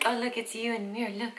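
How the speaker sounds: usual speaking voice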